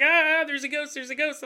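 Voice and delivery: high, half-hearted panic; lightly acted scared homeowner; mock fright. Family scared by the ghost.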